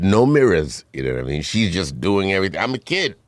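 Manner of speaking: ordinary talk, nothing marked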